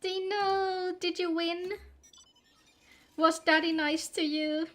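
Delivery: cooing greeting. Real, not acted. Streamer cooing at Dino about Daddy, high baby talk. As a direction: fuss over a friend like a little one.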